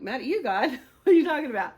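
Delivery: loud, rising disbelief; incredulous. Retold or imitated. Woman retelling her argument with God, incredulous.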